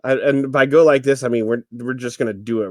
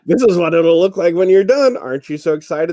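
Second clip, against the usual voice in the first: loud, higher salesman pitch; infomercial host voice; 5 semitones higher, 7 dB louder than usual. Retold or imitated. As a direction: hype the product like a TV ad. Mimicking infomercial demo.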